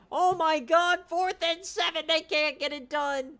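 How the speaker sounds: high, loud outburst of disbelief; loud, high frustrated cry; exasperated shout. Real, not acted. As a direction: yell at the screen in despair. Team failing on fourth down.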